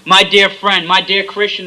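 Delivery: ordinary delivery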